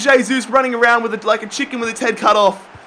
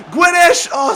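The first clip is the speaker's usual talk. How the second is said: excited shout; 9 semitones higher, 4 dB louder than usual. Real, not acted. Calling the shot.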